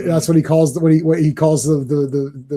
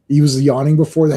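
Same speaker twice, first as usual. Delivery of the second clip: ordinary delivery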